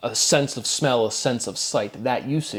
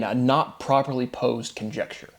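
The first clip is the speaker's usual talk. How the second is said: normal talking voice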